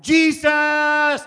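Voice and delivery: high, loud drawn-out cry; shouting. Acted. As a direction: shout for help before the leap.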